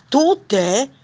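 loud, high rising question; surprised question. Acted. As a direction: blurt it out in surprise. Others react to the owl. (domanda stupita, acuta)

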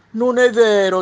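giraffe. Acted. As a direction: contradict them as a long-necked giraffe, loud and stretched. Giraffe voice in read story. (voce forte e allungata da giraffa)

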